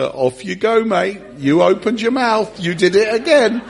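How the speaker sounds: high, loud teasing jeers; mock goading. Other disciples to Peter who opened his mouth, loud goading. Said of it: "imagine the other disciples saying to Peter".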